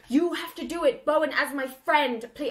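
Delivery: hammed-up earnest begging; Draco pleading. Draco begs Bowen to do it as a friend, pleading. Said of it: "where Draco's like".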